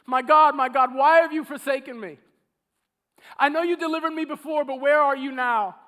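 loud pleading cry to God; a forsaken psalmist crying to God; desperate cry. Retold or imitated. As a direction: call out as if abandoned. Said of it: "he would cry out to God and go".

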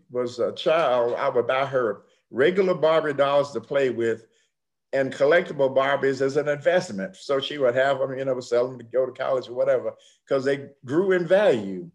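usual speaking voice

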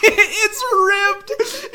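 high loud yell through laughter; cracking up while shrieking; laughing mock yell. Storyteller shrieks punchline in mock horror, laughing.

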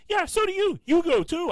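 high, loud protest; indignant shout. Retold or imitated. Son denying to mom he was going, high indignant shout. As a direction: shout the denial back.

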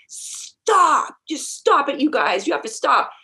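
imitating a frustrated shout at rowdy friends; retold yelling. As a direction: call them off like an exasperated chaperone. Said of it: "you start yelling".

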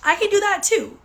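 high, bright aha moment; suddenly see how easy it is; a delighted oh, I can do that; excited realization. Retold or imitated.

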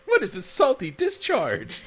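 high, slow, mock-weepy puzzlement; half-hearted imitation of a bewildered crying man; mock sobbing question. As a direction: whine it like a man crying for the first time. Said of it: "he starts crying".

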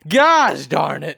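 slow, loud exclamation; exaggerated outburst. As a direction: belt out a hammy curse. Mock outburst about computer lab.

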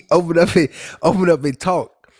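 laughing through the words. Real, not acted. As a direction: chuckle as you repeat it. Laughing at mom's advice.

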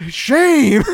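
loud, high cry of shame; mock shaming. Scolding friends over a sleepover remark, mock shaming. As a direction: call it out big and high.

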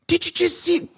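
high, urgent mock demand; imitated outraged mom. Mom to her husband after the shove, high imitated demand.

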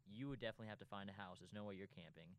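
ordinary talk, nothing marked

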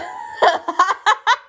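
loud, high peal of laughs; helpless laughter. Real, not acted. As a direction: crack up completely. Laughs at own mockery.

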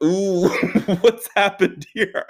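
put-on slow, giggly voice of a bewildered kid; puzzled laughing kid. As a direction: drag it out through a laugh like a confused classmate. Classmate Mike voiced, puzzled.

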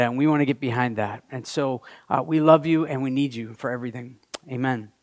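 prayer. Acted. Pastor prays before the sermon.

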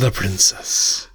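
unvoiced breathy whisper. Repeats whispered sign-off.

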